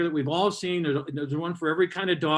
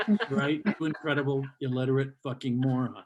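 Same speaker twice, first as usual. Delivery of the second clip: ordinary delivery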